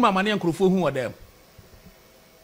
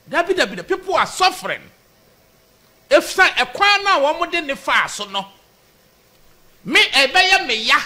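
high, urgent complaint; impassioned protest; 6 semitones higher than usual. Real, not acted. Supporter telling host people are suffering, impassioned and high. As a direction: plead the grievance at raised pitch.

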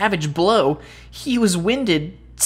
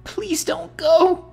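higher, slow, pleading; tearful plea; 10 semitones higher, slower than usual. Acted. Boy pleads with the dying tree, tearful. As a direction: beg him to stay.

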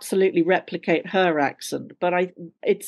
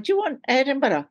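genteel Edinburgh accent; 6 semitones higher than usual. Shown as a demonstration. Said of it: "that kind of Edinburgh accent".

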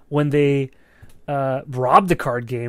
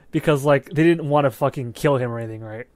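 ordinary talk

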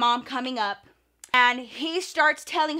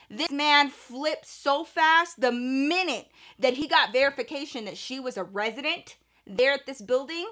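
usual speaking voice

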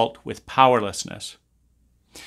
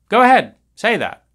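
taunting dare; 9 semitones higher, 7 dB louder than usual. Real, not acted. Taunting challenge, high loud.

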